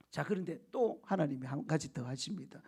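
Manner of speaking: ordinary delivery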